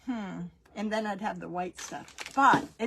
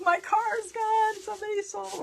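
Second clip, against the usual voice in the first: put-on whining teen; 13 semitones higher than usual. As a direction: drag it out like a distraught teen on the phone. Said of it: "carrying on, calling her friends".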